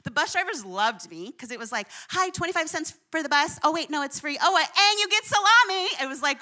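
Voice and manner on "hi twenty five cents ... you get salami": chirpy greeting that climbs higher and louder at the end; cheery bus driver. Retold or imitated. Cheerful bus driver greeting boarding riders, rising excitement. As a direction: play the bus driver delighted to hand out salami.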